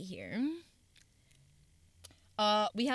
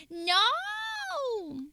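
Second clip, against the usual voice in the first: high mock no; 4 dB louder than usual.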